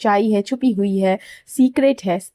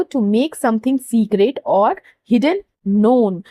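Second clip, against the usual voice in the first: slow, careful English definition; slow clear reading; much slower than usual. Shown as a demonstration. Teacher reading the definition to students, slow and careful. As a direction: read the definition out slowly for the class. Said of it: "English में बोले तो".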